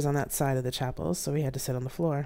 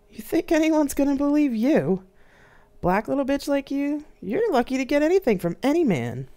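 much higher, louder mocking villain voice; sneering attacker; 12 semitones higher, 6 dB louder than usual. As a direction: taunt her with contempt. Villain taunting her.

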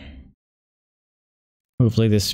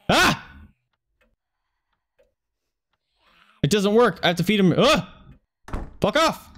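panicked high yelps; 10 semitones higher than usual. Real, not acted. Being chased, keyboard failing.

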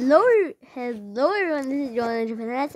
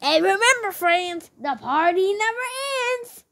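a lightly voiced Willy the animatronic, higher; creepy mascot sign-off, a touch higher; voicing Willy; 8 semitones higher than usual. YouTuber voices Willy the mascot, higher creepy sign-off. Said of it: "let's start with the main man, my favorite, Willy".

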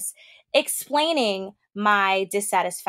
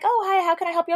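high customer service greeting; mock-friendly agent, higher; sweet agent voice; 8 semitones higher, faster than usual. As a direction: put on the polite agent voice. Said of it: "Monica's like".

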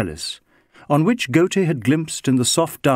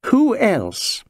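dry scorn; 10 semitones higher than usual. Acted. Doctor's dry retort.

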